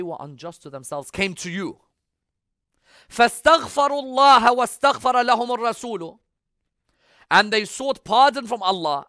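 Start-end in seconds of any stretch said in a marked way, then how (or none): 3.1-6.2 loud, high Arabic recitation; Quran recitation. Real, not acted. Preacher quoting God's word to the congregation, ringing recitation. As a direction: recite the verse forcefully, voice raised.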